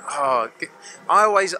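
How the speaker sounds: ordinary talk, nothing marked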